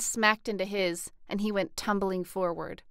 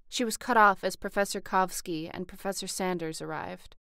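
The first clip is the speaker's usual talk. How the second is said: ordinary delivery, nothing marked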